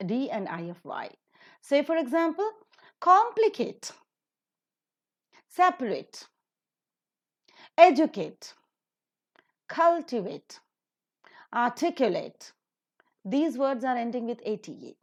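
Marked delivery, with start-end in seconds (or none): 3.0-12.5 complicate, separate, educate, cultivate, articulate, stressed third syllable from the end; COM-plicate, SEP-arate, ED-ucate, CUL-tivate, ar-TIC-ulate, word by word; antepenultimate stress. Shown as a demonstration. Teacher models the rule, complicate to articulate accented third from last. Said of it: "third from the last syllable is to be accented".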